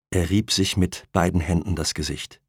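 usual speaking voice

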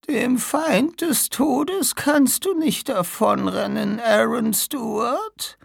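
high, slow, breaking whisper then cry; dying old woman. Acted. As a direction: struggle for breath to warn them. (sterbende alte Frau, stockend)

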